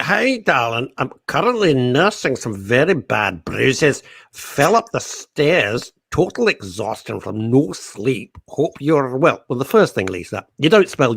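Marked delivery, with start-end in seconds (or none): 0.0-9.4 put-on slow Scottish Billy Connolly accent read. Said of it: "what about Billy Connolly?".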